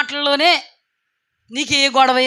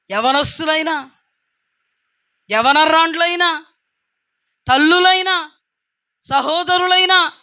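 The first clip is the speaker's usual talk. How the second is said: each word climbing higher and louder; building sermon cry; 4 semitones higher, 4 dB louder than usual. Acted. Preacher calling out to every group in the church, climbing louder.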